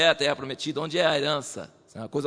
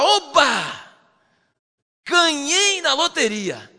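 higher, louder, slow joyful cry; overjoyed; 10 semitones higher, 6 dB louder, much slower than usual. Retold or imitated. Preacher acts out David's joy, high and loud. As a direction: shout it like a lottery win. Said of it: "Ele fala".

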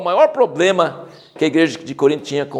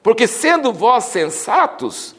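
sarcastic reading; 5 semitones higher, slower than usual. Acted. Reads Paul's sarcastic verses.

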